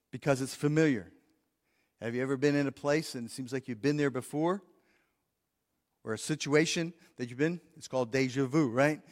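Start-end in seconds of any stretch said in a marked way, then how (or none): none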